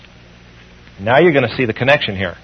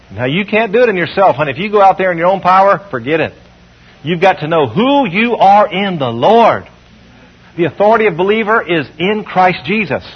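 ordinary talk

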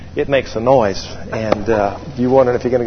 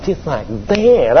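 slow, sneering mimicry; mocking; slower than usual. Pilot mocking fuel driver on the inside, mocking. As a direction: parrot him back with drawn-out scorn. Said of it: "I mean, I was mocking him".

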